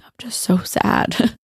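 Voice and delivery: a slow retold whisper of grief; whispered sadness. Crying at night, held by husband.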